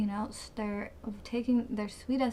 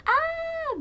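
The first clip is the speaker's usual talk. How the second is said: high, loud "ah" at a tickle; startled squeal; 5 dB louder than usual. Real, not acted. Vlogger feels sweat drip down the back, startled squeal.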